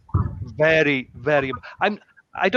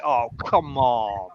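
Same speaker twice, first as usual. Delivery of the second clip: slow, drawn-out pretend whine; playful groan to the strict student; mock whining; 5 semitones lower, slower than usual.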